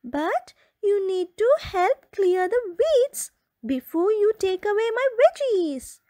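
high, bright little rabbit setting terms; squeaky rabbit making a deal. Acted. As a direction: speak as the small rabbit, firmly. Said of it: "opened the door and said".

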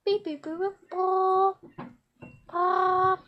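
imitated phone beeps. Child pretending to call Applejack, imitated beeps. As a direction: pretend dial tones.

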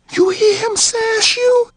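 high, needling trickster voice; sly rabbit. Acted. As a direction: goad him on.

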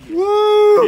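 high loud whoop; excited whoop. Real, not acted. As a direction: whoop in triumph. Reaches summit sign.

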